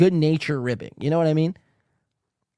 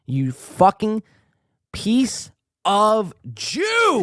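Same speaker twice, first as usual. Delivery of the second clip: drawn-out insult; 5 semitones higher, much slower than usual. Real, not acted. Insulting the host on screen.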